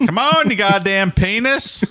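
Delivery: high, slow mock scolding; mock exasperation. Comedian pretends to talk to his wiener, mock exasperated. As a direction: plead with it in comic exasperation.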